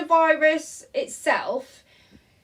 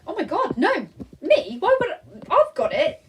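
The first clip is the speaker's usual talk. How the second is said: high, incredulous reenactment; retold disbelief; 6 semitones higher than usual. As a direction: act out your shocked disbelief. Retells shock at positive test result.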